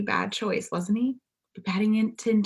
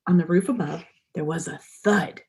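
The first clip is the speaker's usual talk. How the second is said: usual speaking voice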